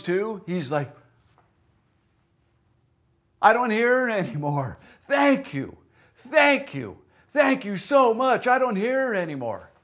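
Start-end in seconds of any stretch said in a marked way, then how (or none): 3.4-9.7 high-pitched, acted-out elated thanks; overjoyed relief. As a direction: imitate a man bursting with sudden relief. Retold freed man thanking them.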